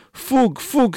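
very high delighted shout; joyous cry. A narrator's reading. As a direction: burst out with pure joy. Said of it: "striga cuprinsă de o bucurie fără margini". (strigăt de bucurie fără margini)

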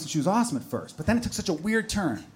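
usual speaking voice

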